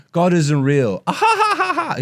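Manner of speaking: very high cackling mock laugh; obnoxious laugh imitation. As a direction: ham up a grating comic's laugh. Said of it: "His obnoxious laugh".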